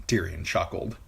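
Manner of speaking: plain reading voice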